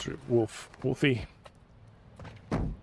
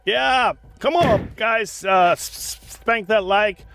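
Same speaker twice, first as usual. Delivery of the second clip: high, excited shout-out; rally the viewers, pitched up; slow, high "yeah", then urging; hyping the crowd; 7 semitones higher, slower than usual. Real, not acted.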